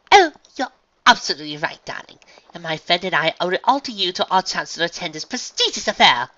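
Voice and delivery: high, loud, gushing society lady; refined unicorn flattering. Acted. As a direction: gush politely to an important man.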